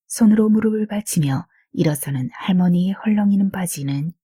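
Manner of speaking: plain reading voice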